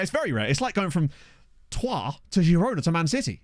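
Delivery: ordinary talk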